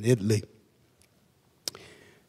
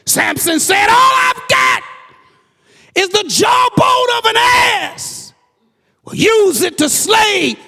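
high, loud shouted sermon cadence; shouted preaching in call-and-answer rhythm; full-force preaching; 6 dB louder than usual. Acted. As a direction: hammer each example home to the congregation. Preaches through biblical examples.

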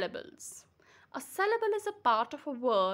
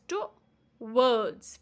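towards as to, pause, wards; two separate beats, to-wards; split into syllables. Shown as a demonstration. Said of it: "we have two syllables".

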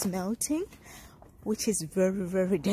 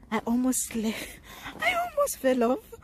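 high, laughing over a near slip; 5 semitones higher than usual. Real, not acted. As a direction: laugh it off, relieved. Laughs about nearly slipping.

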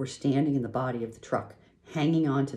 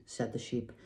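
plain reading voice

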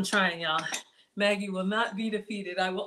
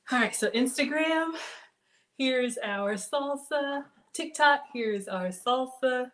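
showing off; 4 semitones higher, slower than usual. Real, not acted. Presenting salsa to each platform.

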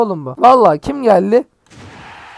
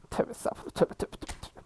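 whispered under the breath, repenting; 17 dB quieter than usual. Real, not acted. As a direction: hush it out like a quick prayer of regret. Regret after cursing the game. (fısıltıyla tövbe)

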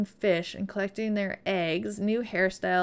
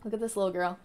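ordinary talk, nothing marked

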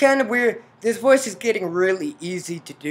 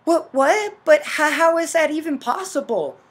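higher, stammered questions; incredulous heroine; 9 semitones higher, 6 dB louder than usual. Acted. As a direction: act out stunned disbelief. Heroine stunned by revelation.

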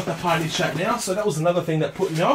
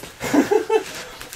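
high breathy laugh; breathy giggle. Real, not acted. Vlogger chuckling to viewers over a cheap deal.

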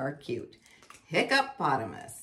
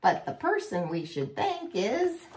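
boastful voice; 11 semitones higher, slower than usual. Acted. Boastful animal voice.

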